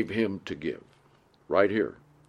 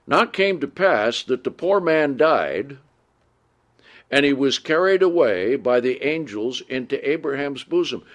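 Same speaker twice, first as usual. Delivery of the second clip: louder, measured Bible reading voice; scripture reading; 5 semitones higher, 4 dB louder than usual. Acted. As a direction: read the verses out to the congregation with weight. Reads scripture aloud.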